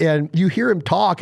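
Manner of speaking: ordinary talk, nothing marked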